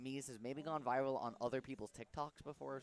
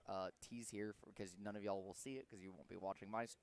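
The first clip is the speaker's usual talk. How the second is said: ordinary talk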